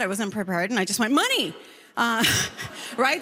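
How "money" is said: high, blurted answer; sudden pitched-up blurt; caught off guard. Retold or imitated. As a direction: blurt it out unprepared. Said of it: "I wasn't expecting that".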